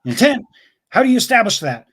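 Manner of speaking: high, incredulous questioning from a boss; challenging superior. Acted. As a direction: act out a superior challenging his detective. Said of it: "or impression".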